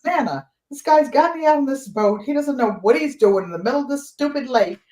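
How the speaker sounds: exasperated nephew. Retold or imitated. Nephew complaining to Nana from the rowboat, mildly exasperated. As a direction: imitate a kid fed up with a boat mate.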